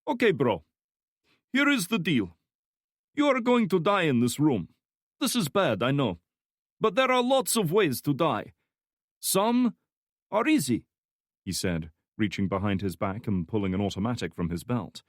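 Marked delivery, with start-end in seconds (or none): 0.1-10.8 put-on high, loud menacing Slavic accent; cheerful threat, thick Slavic accent; heavy Slavic accent. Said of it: "speaking in a heavy Slavic accent".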